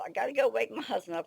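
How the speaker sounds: normal talking voice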